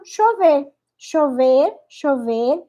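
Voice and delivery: "chover" with a sh sound, Argentine style; Argentine accent. Shown as a demonstration. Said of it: "E o argentino".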